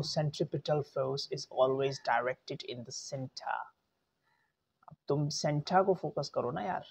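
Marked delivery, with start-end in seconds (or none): 0.0-3.6 measured delivery, "center" as SEN-tuh; careful, slow British-style sentence; slower model sentence. Shown as a demonstration. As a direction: take it slowly, ending "center" without R. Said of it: "अब मैं बोलूँ".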